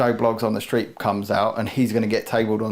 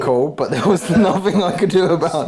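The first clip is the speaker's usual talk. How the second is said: laughing; 7 semitones higher, 5 dB louder than usual. Real, not acted. Laughing off a teammate's joke.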